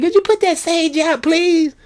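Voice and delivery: very high, far put-on voice; teacher asking. Teacher telling a kid to stop burning sage, very high voice. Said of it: "She said".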